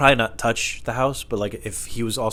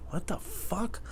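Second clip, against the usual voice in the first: baffled; 13 semitones higher, 9 dB quieter, much slower than usual. Retold or imitated. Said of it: "And I was like".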